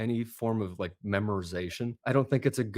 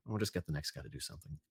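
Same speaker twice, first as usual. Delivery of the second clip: muttered quickly, low and much softer; off-mic aside; 6 semitones lower, 14 dB quieter, faster than usual. Retold or imitated. Director dismissing an actor off the talkback mic, muttered aside. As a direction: toss it off under your breath, low and hurried. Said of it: "off the talkback mic, they go".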